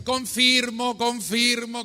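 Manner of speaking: high, loud insistent repetition; emphatic confirming call. Retold or imitated. Priest imitating a voice confirming the sign, loud and high. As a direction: ring it out like a stamp of approval.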